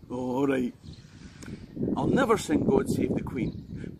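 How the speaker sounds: ordinary delivery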